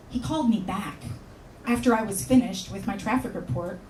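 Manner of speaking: ordinary talk, nothing marked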